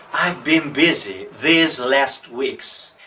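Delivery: ordinary delivery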